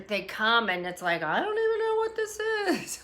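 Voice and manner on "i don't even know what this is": very high, squeaky mock wail; mock tearful bewilderment. Streamer voicing sudden feelings, mock tearful bewilderment. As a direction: imitate being helplessly overwhelmed, way up high. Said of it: "sometimes the feelings are".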